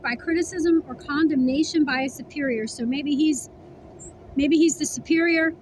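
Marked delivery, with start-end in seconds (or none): none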